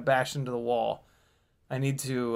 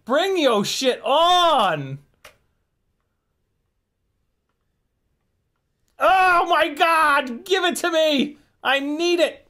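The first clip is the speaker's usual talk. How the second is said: fired-up shouting; 12 semitones higher, 5 dB louder, slower than usual. Real, not acted. Challenging the drone, shouting.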